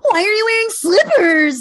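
mock her shrill complaint in a high squeak; Kyle's voice. Podcaster mimics Kyle questioning sister's slippers, high whiny mock voice. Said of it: "wait, let me do her voice".

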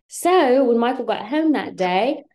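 storytelling in mock British; playful put-on Brit; fake British accent. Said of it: "speaking in my British accent".

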